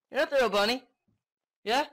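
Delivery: loud, high-pitched accusation; escalated accusation. Pretend. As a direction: yell it at the creature, voice jumping up. Repeated taunt, escalated take.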